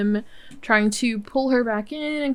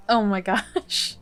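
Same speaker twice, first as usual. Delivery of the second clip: normal talking voice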